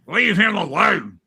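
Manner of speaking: imitating a grouchy old cuss; mock-irritated telling-off; cranky husband. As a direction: put on a cranky husband's scolding. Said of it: "this cranky cuss".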